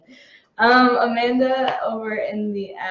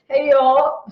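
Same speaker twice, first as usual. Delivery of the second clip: playful stab at an Australian accent; attempted Australian accent; 3 semitones higher than usual. Shown as a demonstration. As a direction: try out a shaky-authenticity Australian accent. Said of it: "read this next one in an Australian accent".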